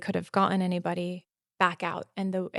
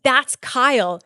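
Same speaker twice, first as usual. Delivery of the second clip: excited; 5 semitones higher, 10 dB louder, slower than usual. Real, not acted. Recognizing her rescuer.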